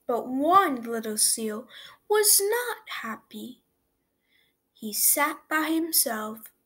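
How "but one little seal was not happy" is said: ordinary delivery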